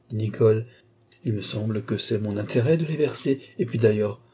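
ordinary delivery

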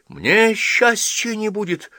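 put-on high, slow, loud hurt child's question. A narrator's reading. Girl asks the old priest about her own happiness, hurt. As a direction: ask it wounded, drawn out. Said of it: "обиженно спросила Ася".

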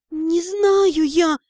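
tearful. A narrator's reading. Crying student repeats.

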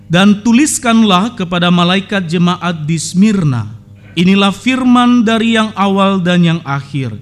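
loud, measured Bible reading; solemn scripture reading. Acted. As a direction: proclaim the verses to the congregation. Reads scripture aloud. (pembacaan Alkitab yang khidmat dan lantang)

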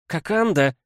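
high, loud indignant cry; exclaiming. Acted. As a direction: call his name out in protest. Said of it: "воскликнула Шри Кимбоджа".

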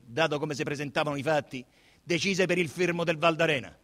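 ordinary delivery

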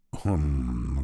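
low, hesitant hum; embarrassed. A narrator's reading. Orc accused of starting it, embarrassed. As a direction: hum as if caught out. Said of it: "вдруг смутился орк".

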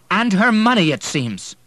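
loud, high biting jab; angry sneer. Acted. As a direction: throw the taunt hard. William sneers during quarrel.

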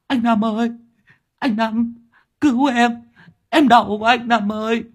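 very high, wailing plea; tearful whimper. A narrator's reading. Sáu Bưởi begging his boss for help, tearful. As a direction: whine pitifully for rescue. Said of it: "Sáu Bưởi mếu máo".

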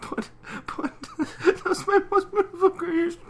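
very high, loud wail through laughter; mock distressed Rarity. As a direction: squeal over a precious creation, laughing. Rarity's acted distress amid laughter.

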